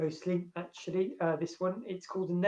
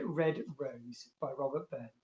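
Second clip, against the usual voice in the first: usual speaking voice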